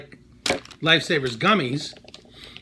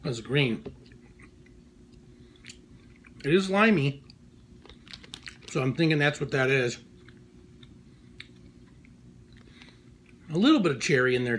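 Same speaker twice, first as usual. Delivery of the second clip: talking around candy; chewing while talking. Real, not acted. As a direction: mouth partly full. Seen 1: Gummy goes in mouth at -1; pursed, working mouth while talking.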